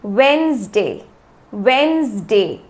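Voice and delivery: वेडनेसडे slowly as WENS-day; low, falling वेडनेसडे, said wens-day; silent d, repeat-after-me. Shown as a demonstration. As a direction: model वेडनेसडे without its first d for learners. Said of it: "मेरे साथ में बोलिए".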